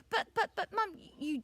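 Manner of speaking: put-on high, flustered child stutter; puzzled little boy spluttering; stammering protest. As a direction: object in a high, halting burst. Boy protests mum's odd request.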